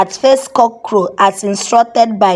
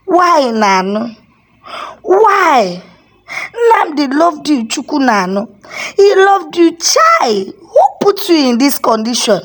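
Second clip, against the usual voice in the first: high anguished crying question; grieving in tears; 5 semitones higher than usual. A narrator's reading. Father grieving over his murdered son, crying high. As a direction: ask through sobs of grief. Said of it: "asked in tears".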